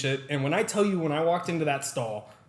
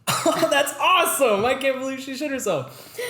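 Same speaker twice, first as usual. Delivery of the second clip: sarcastic mock delight; 11 semitones higher, 6 dB louder than usual. Reacting to coworkers about the old lady, mock glee. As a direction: ham up fake joy, much higher and louder.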